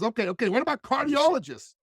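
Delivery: high, keen sales pitch of an idea; eager pitch. Retold or imitated.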